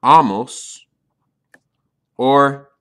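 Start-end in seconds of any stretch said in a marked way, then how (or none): 0.0-0.8 amos stressed on the first syllable, said loud; amos, loud and clear. Shown as a demonstration. Teacher repeating amos loudly for students. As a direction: pronounce amos firmly for the class. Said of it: "will end in".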